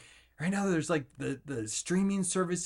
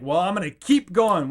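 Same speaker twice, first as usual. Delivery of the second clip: defiant loud; 5 semitones higher, 7 dB louder than usual. Pretend. Defiant reply to life.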